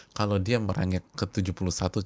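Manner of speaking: ordinary delivery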